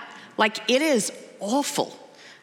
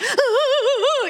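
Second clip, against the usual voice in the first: high, loud run of mock sobs; rapid high-pitched wailing sobs, imitated; imitated sobbing; 5 dB louder than usual. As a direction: act out a child's paralyzed sobbing. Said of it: "he's just sobbing".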